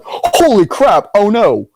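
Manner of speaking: high, drawn-out mock dread; retold alarm. Fan recalling a terrifying film moment, mock-alarmed. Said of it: "that moment terrified me".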